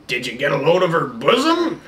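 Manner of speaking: old Texan accent, lightly put on; dirty old Texan. Mocking the King of France as an old Texan. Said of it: "The dirty old Texan is what it sounded like".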